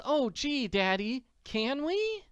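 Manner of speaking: put-on a child begging for a treat; eager Annie. Annie asks Daddy if they can visit the Silos, eager and high.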